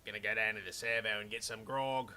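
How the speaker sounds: low jokey Australian bloke; Australian accent. Acted. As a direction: drop the pitch and go full Australian. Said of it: "It's funny that the Australian accent".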